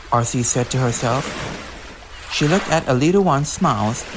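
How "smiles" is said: plain reading voice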